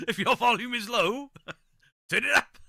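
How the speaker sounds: high, loud words through laughter; real laughter breaking up the words; laughing. Real, not acted. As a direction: crack up while calling out the instruction. Laughing at sound complaints.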